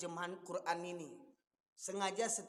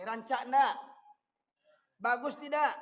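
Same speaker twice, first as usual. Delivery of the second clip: louder rhetorical question thrown at the crowd; loud challenge; 3 semitones higher, 7 dB louder, slower than usual. Real, not acted. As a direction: demand an answer from the hall. Preacher asks the audience. (tanya lantang kepada jamaah)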